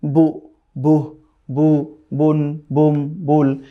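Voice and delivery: each syllable drawn out slowly; slow, careful syllable drill. Shown as a demonstration. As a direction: stretch every sound for the learner. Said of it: "descending স্টাইলেও পড়তে পারেন".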